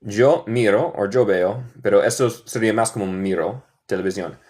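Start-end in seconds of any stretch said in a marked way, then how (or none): none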